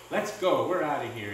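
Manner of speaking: ordinary delivery, nothing marked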